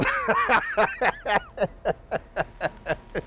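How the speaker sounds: high, soft run of laughter; drawn-out high giggling fit; long laugh. Acted. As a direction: cackle away at your own joke. Laughs at own joke's punchline.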